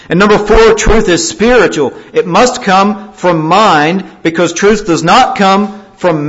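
loud, high, emphatic sermon argument; forceful preaching. Real, not acted. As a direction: hammer each point home from the pulpit.

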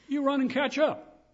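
high, loud order to hurry; urgent command. Acted. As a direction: call it out as a sharp command. Spirit's command to Philip, acted.

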